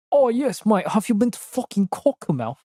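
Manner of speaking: mock drunk Brit. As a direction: ham up a tipsy British mate. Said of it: "They were just losing their mind".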